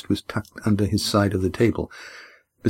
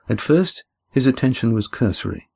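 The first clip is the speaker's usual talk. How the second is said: plain reading voice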